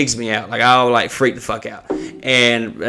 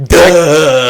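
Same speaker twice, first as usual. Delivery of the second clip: loud, dopey drawn-out jeer; mock her stupidity with a loud drawl; mocking duh; 3 semitones higher, 9 dB louder than usual. Mocking her obvious answer.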